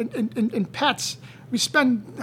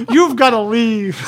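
slow, laughing mock order; laughing through it; 8 dB louder, slower than usual. Laughing as he retells Heather ordering him out.

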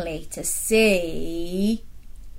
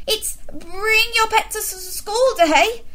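higher, flustered stammer; spluttering teacher; 12 semitones higher than usual. Acted. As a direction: trip over the words in a nervous fluster. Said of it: "spluttered Mister Bright".

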